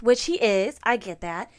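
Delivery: ordinary delivery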